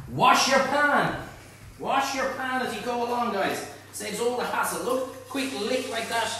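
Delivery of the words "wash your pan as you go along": ordinary delivery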